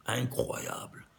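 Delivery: a hushed whisper of amazement; awed whisper. Real, not acted. Teacher marvelling at the number to his students, whispered. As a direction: breathe the word out, almost to yourself.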